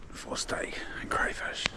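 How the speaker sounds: slow hushed whisper. Acted. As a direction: breathe it out in a whisper. Whispered aside at the cooking spot.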